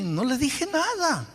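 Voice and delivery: "no le dije nada" mucho más agudo y lento, de autojustificación; indignado. Retold or imitated. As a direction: di "nada" subiendo agudo, ofendido. Se justifica indignado. (insistiendo "no le dije nada" agudo y lento)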